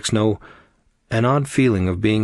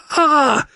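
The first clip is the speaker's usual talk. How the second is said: sharp high scream of fright; startled scream; 5 dB louder than usual. A narrator's reading. Nick sees the shadow, high scream. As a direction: shriek in sudden terror. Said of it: "He turned screaming".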